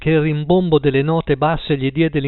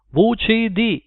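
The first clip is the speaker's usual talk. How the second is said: higher switchboard operator; 8 semitones higher, slower than usual. A narrator's reading. Operator answering Paul's call, lightly voiced.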